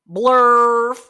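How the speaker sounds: blurted out loud; loud silly word. Acted. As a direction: blurt it loudly.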